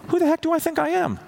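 shot up high in disbelief; incredulous outburst. Real, not acted. Preacher rebuking the congregation's arrogance, incredulous and high-pitched. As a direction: challenge them, high and sharp.

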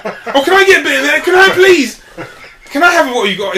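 high, loud, whiny mock begging while laughing; hammed-up entitled scrounger voice; entitled mooch. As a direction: mimic a greedy friend begging for a share. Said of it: "that entitlement".

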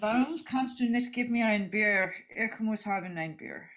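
put-on German accent. Mock German demand for beer.

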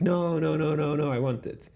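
mock kids begging to keep it; kids pleading. Retelling the kids refusing to let the toy go, pleading.

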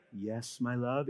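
put-on soft, tender reply to a child; mock sweet whisper of love; sugary soft parent voice. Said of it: "it wasn't like".

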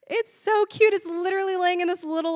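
high-pitched adoring squeal of words; gushing. Retold or imitated. Stoned woman sees puppy photo on phone, gushing. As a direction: coo over a puppy, pitched right up.

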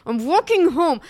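husband answering his wife's call, loud and sulky; stubborn reply. Retold or imitated. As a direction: fire it back like a stubborn drunk.

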